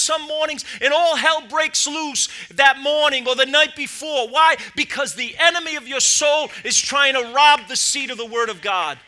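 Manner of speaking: loud, driving sermon voice; full-force preaching. Acted. Pastor preaching to his church, loud and driving. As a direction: preach it hard to the congregation.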